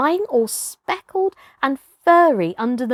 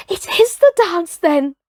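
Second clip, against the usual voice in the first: higher and louder, thrilled discovery; excited realisation; 7 semitones higher, 5 dB louder than usual. A narrator's reading. Toomai crying out as he realises the dance is real, excited. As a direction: burst out in awed excitement.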